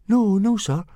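put-on high, anxious-to-please boy; high-pitched hasty reassurance; reassuring. A narrator's reading. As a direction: placate him in a high little voice. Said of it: "murmured Fishlegs reassuringly".

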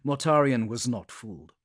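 ordinary delivery

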